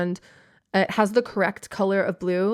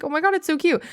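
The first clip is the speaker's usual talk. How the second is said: excited gushing; 8 semitones higher, faster than usual. Retold or imitated. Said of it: "And I was like".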